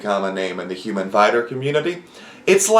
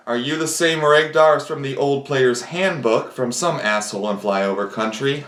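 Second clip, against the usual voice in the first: ordinary delivery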